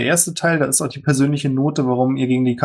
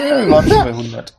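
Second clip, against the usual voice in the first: exasperated protest; 10 semitones higher, 5 dB louder than usual. Acted. Bartering in protest.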